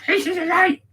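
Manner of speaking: very high squawking parrot. Pretend. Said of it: "do it in a parrot's voice".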